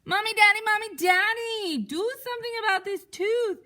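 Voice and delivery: high, loud cry of tooth pain; loud, high yelping child in pain; pained yell. Acted. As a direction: wail for Mommy like a hurting kid. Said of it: "and yelled".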